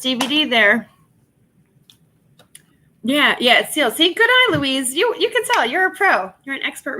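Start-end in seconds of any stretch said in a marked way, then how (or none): 3.9-7.0 high, loud, bubbly praise; higher and louder excited compliment. Real, not acted. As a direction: cheer Louise on for spotting it. Praising viewer Louise.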